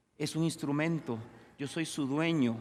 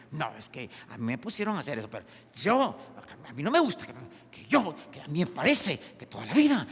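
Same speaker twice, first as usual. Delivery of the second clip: hammed-up excuse-maker, pitch leaping on key words; mock protesting; 4 dB louder, faster than usual. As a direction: imitate someone arguing back, indignant. Mimics people arguing against surrender.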